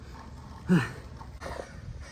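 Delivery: heavy exhausted exhale; long winded breath out; breathless sigh. Real, not acted. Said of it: "So out of breath".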